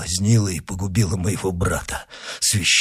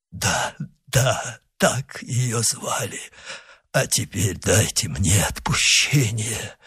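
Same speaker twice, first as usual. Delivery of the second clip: soft, faltering voice of a dying man; dying weakness; 6 dB quieter than usual. Acted. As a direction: answer feebly, with little breath left. Dying man confirms, begs absolution.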